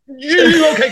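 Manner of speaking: mock relief. Relief after paying at theater.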